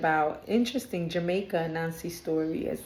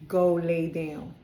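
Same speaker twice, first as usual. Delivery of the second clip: slow, firm command; slower than usual. Real, not acted. Reader repeating an order to her pet, slow and firm. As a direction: GO LAY DOWN drawn out at normal volume.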